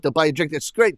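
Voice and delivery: normal talking voice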